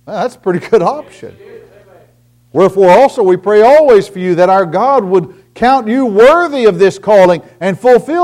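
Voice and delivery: loud, high fervent preaching. Acted. Preacher pleading with his congregation, loud and fervent.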